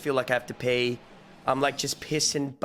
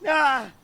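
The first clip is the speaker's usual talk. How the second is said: loud high startled yell; sudden yelp; 12 semitones higher, 5 dB louder than usual. Real, not acted. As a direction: shout out in alarm.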